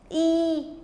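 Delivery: ई held long and level; long sound. Shown as a demonstration. Teacher answering whose sound is heard, ई drawn out. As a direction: sustain ई as one long vowel. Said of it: "long sound".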